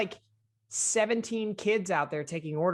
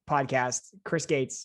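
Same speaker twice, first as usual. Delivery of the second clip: ordinary talk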